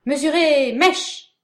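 high, loud battle orders; shouting commands. Acted. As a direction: bark orders to the troops. Officer commanding troops.

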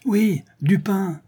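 slow, high drawn-out cry; crowd echoing demand. A narrator's reading. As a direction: echo it from the back of the mob. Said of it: "répétèrent ceux qui étaient le plus loin". (cri repris par la foule)

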